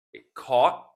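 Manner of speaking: "caught" with the final T held back, unreleased; silent T. Shown as a demonstration. Teacher models "caught" for learners, final T left silent. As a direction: stop "caught" short without sounding the T. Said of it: "again the silent or flap T".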